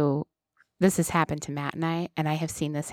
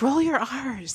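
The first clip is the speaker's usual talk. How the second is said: Spanish teacher; 7 semitones higher, slower than usual. Retold or imitated. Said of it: "your Spanish teacher used to be like".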